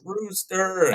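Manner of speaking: high-pitched mock call, drawn out; imitated yell. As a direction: call it out like a hunter, without real volume. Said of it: "somebody yells".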